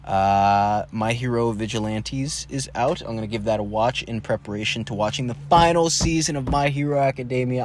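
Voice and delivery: ordinary talk, nothing marked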